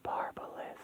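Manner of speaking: very soft, voiceless whisper; eerie whisper. Acted. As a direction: whisper it like a faint transmission. Eerie voice sends a transmission.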